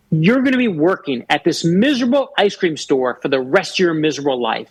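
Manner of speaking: normal talking voice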